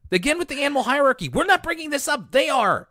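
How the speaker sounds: high-pitched, exasperated protest; comic outburst of exasperation; mock exasperation. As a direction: protest it high, in exaggerated disbelief. Mock protest over animal hierarchy.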